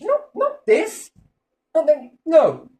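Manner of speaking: high, slow scornful refusal; mock dismissive leader. World leader slowly rejecting the tiny god. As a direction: wave it off scornfully.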